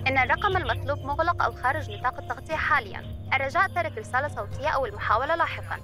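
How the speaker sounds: error message. Acted. Phone network recorded message.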